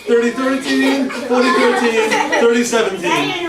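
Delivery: loud, high counting chant; excited counting. Real, not acted. Counting aloud, joking numbers.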